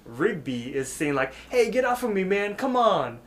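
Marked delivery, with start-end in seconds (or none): 1.5-3.2 high-pitched imitated protest. Rigby overheard fighting off bouncers, high protest. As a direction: whine in a raised voice to be released.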